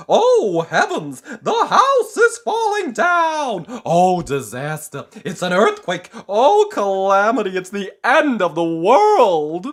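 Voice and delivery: loud, high hysterical wailing; panic. Acted. Mister Jelly sure the house is collapsing, loud hysterical panic. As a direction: panic wildly.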